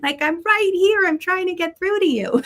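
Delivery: high, pleading angel voice calling her name; high-pitched nagging guardian angel; exasperated angel. Retold or imitated. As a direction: play a fed-up angel trying to get through. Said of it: "the angel up there going like this".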